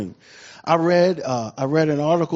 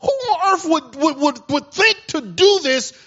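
outraged king; 9 semitones higher, 4 dB louder than usual. Retold or imitated. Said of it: "And he said".

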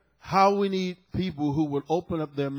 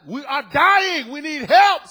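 shouted, slow and much higher, almost wailing; mayor crying out; 8 semitones higher, 11 dB louder, slower than usual. Retold or imitated. Preacher voicing the mayor of San Juan begging for help, desperate shout. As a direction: cry it out like a desperate plea for help. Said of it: "She says".

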